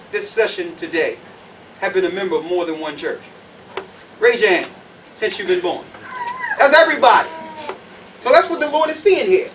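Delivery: usual speaking voice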